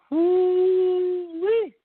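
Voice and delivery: high, loud retold whoop. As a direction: let out a big excited woo-wee. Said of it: "I made a loud noise".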